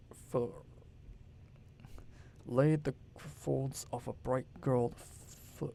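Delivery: slow, hushed, halting poem reading, nearly whispered; low, stumbling reading. Acted. Montag reads the poem to the women, low and stumbling. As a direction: falter over each line as if afraid to read. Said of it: "read in a low, stumbling voice".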